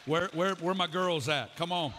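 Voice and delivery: loud rally call to the church women. Real, not acted. Preacher rallying the women in the congregation, loud call-out. Calling out to the crowd.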